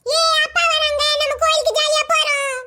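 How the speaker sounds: put-on gleeful childish announcement; delighted child. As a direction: celebrate like a little kid. Child rejoices dad is coming.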